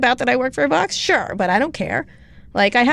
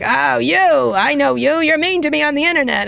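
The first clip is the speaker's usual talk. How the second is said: higher, louder mocking Scaramucci voice; Scaramucci impression; 6 semitones higher, 4 dB louder than usual. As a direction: play him as pleased to catch her. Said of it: "and he's like".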